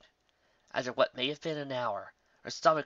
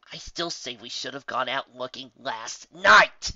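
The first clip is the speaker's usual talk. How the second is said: shouted; 9 dB louder, slower than usual. Acted. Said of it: "Rainbow Dash shouted".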